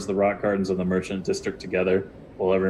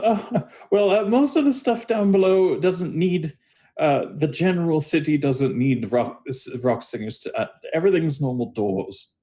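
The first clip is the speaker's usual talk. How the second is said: higher, halting character voice; flustered David explaining; 7 semitones higher than usual. Acted. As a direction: fumble through an explanation, pitched up at first. GM answers as David.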